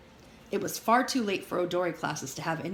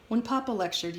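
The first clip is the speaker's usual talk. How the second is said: ordinary delivery, nothing marked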